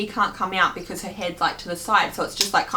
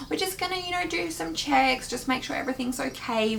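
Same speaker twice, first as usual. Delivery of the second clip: soothing doctor; 7 semitones higher, 4 dB quieter than usual. Retold or imitated. Doctors soothing scared mother.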